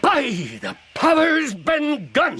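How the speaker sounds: relieved shout of the name; sudden shout of recognition; 1 excited exclaim. Acted. Pirates realise whose voice.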